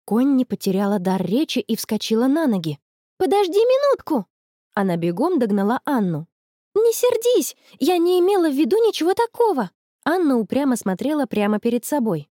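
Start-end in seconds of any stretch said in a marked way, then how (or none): 3.2-4.3 high-pitched urgent plea to wait; urgent, high call; calling after. A narrator's reading. Running after her offended friend. 6.7-9.7 high, urgent plea; high-pitched protest of innocence; pleading apology. A narrator's reading. Apologising to her offended friend.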